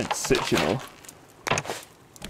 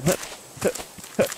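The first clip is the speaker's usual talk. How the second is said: ordinary delivery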